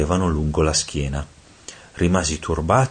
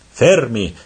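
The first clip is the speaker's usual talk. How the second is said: loud, raised command; exclaiming; 5 semitones higher, 5 dB louder than usual. A narrator's reading. Head devil halts his fellow devils, exclaiming loudly. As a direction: halt them with a sharp exclamation. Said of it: "esclamò Malacorda".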